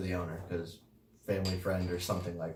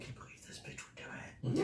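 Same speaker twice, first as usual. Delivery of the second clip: whispered snide aside with a laugh; catty whisper; 12 dB quieter than usual. Retold or imitated. The fake coworker gossiping behind someone's back, whispered.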